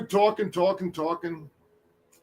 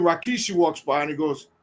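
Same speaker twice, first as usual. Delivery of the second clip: ordinary delivery, nothing marked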